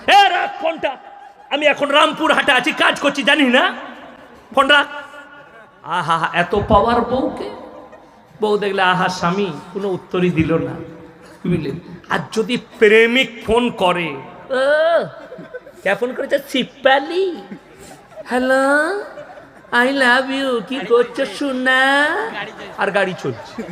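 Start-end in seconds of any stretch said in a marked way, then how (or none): 0.1-4.9 rude husband. Retold or imitated. Husband brushing off wife's call. 14.5-22.1 sweet-talking lover. Retold or imitated. Husband sweet-talking lover on phone.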